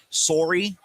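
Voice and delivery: sorry with a Canadian soar-ee; Canadian accent. Shown as a demonstration. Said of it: "You like my Canadian accent?".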